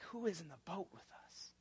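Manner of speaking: very soft, breathless awed question; hushed awe. Acted. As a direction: barely say it, stunned. Disciples are like, hushed take.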